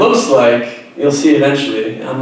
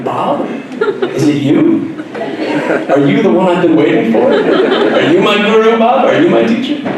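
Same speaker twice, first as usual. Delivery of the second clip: high, laughing mock reverence; hammed-up hopeful wonder; mock hopeful awe; 8 semitones higher than usual. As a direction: hail him like a long-awaited guru. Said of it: "And I'm thinking".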